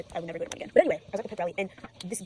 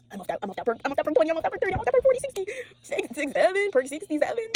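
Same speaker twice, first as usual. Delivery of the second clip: building higher and louder, wired mock brag; hyped mock chant climbing up; mocking hyped people; 10 semitones higher, 8 dB louder than usual. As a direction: imitate someone buzzing on pills, rising. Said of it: "those people who pop ashwagandha".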